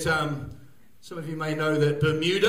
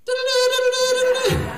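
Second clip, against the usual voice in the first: very high, loud falsetto "Diddle-doo, diddle-doo"; loud falsetto ditty; high singsong; 7 dB louder than usual. Retold or imitated. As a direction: squeal it out high like a silly tune. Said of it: "she sent me back a text that just said".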